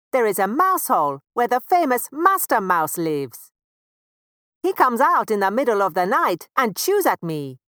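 a lightly voiced rope; sleepy rope. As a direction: explain from the corner. Rope explains Master Mouse.

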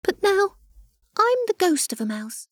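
high, tiny, wistful ghost voice; a sad little ghost mouse. A narrator's reading. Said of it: "it said with a shake of the head".

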